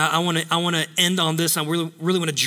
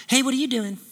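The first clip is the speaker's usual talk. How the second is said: higher, casual come-on voice; flirty admirer; 5 semitones higher, faster than usual. Acted. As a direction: play someone sidling up to chat. Acted tempting chat with preacher.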